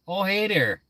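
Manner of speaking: the Fargo-style greeting; lightly hammed Minnesotan hello; Minnesota accent. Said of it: "I have an accent".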